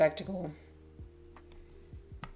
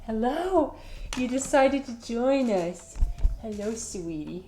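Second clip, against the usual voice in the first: much higher, slower cooing hello; greeting the cat; 5 semitones higher than usual. Real, not acted. Streamer greets cat joining the stream, high delighted cooing.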